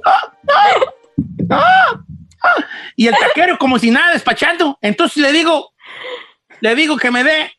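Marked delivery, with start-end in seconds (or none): 0.1-2.6 high, louder strangled gasps from a stuck taco; mock choking gasps. Choking on taco, gasping at the taquero. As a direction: gag on the meat.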